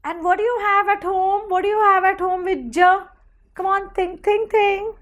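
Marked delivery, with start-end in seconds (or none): none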